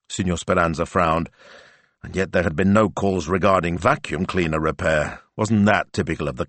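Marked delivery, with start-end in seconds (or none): none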